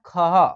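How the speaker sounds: खह: ख with a short breathed ह after; visarga sound. Shown as a demonstration. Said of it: "अह की मात्रा जब लगेगा तो क्या पड़ेगा". (खह को अंत की ह ध्वनि के साथ बोलें)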